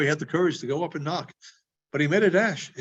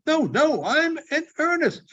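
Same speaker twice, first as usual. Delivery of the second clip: high, eager insistence; eager insisting; 11 semitones higher than usual. Acted. Scrooge insisting to the boy, high and eager. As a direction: insist with a laugh in your voice.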